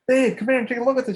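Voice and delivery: high-pitched call to a partner; imitated excited calling. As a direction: act out summoning someone to see a marvel. Imitating husband calling his partner over.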